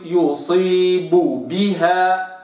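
slow يصيب بها, both ب closed; slow careful recitation; lips fully closed. Shown as a demonstration. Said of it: "وإنما".

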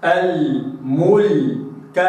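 "الملك" very slow, the lam held before the kaf; slow recitation of al-mulk. Shown as a demonstration. Teacher reciting "الملك" with the lam held long. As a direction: give the lam in "الملك" its full time. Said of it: "هكذا".

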